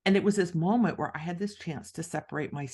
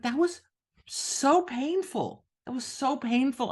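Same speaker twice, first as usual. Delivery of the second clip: higher, drawn-out moan; exaggerated pained complaint; 7 semitones higher than usual. Author telling her mentor how painful it was, high mock whine. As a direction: whine it, stretching the so.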